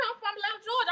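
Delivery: Atlanta Southern accent laid on a little thicker. Shown as a demonstration. Answering a viewer's question in a thicker Atlanta accent. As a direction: lean further into the Atlanta accent. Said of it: "they love your accent".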